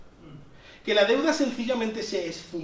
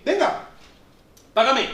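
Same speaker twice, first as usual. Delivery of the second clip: mock king's demand, louder; a king calling in the debt; 3 semitones higher, 5 dB louder than usual. King demanding payment from servant, louder.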